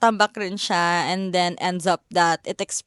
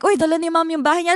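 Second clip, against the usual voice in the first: teasing passerby; 8 semitones higher, much faster than usual. Retold or imitated. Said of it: "people are like".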